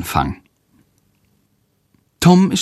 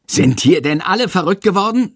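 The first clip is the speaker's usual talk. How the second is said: high exasperated shout; teacher losing patience, yelling; teacher shouts at the noisy class; 10 semitones higher than usual. Acted. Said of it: "schreit Herr Lersikow".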